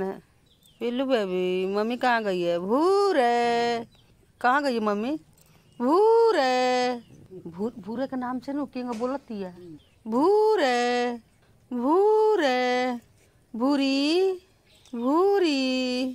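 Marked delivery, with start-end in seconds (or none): 2.6-3.8 long drawn-out call. Real, not acted. Owner calling out for the missing dog, drawn-out call. 5.8-7.0 long drawn-out call. Real, not acted. Owner calling out for the missing dog, drawn-out call. 10.1-16.1 long drawn-out call. Real, not acted. Owner calling out for the missing dog, drawn-out call.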